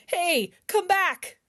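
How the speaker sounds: calling after. Acted. Calling his sister back.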